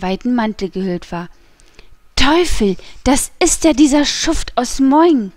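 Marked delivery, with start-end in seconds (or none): 2.2-5.3 louder, higher outburst of recognition; sudden exclamation. A narrator's reading. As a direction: cry out in sudden indignation. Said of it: "rief der D'Artagnan plötzlich". (plötzlicher, empörter Ausruf)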